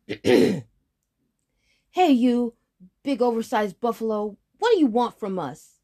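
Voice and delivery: high, loud taunting call; shouting. Acted. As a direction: call out bravely. Boy calls out to giant.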